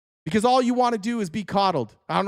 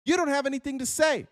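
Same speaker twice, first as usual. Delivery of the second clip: ordinary talk, nothing marked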